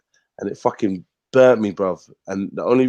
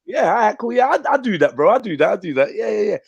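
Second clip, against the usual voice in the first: high, chummy yes-man voice; eager agreement; 9 semitones higher than usual. Retold or imitated. Man with the ego given charge, eagerly agreeing. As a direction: imitate a flattered mate readily agreeing.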